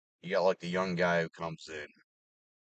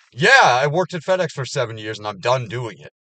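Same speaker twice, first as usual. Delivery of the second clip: much louder, fed-up answer; loud retort; 5 semitones higher, 13 dB louder than usual. Real, not acted. Answering the kids at work, loud fed-up. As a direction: shout it back, done with it.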